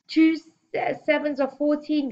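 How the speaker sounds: high, halting schoolboy gasp. A narrator's reading. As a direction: force the answer out in gasps. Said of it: "gasped Robert".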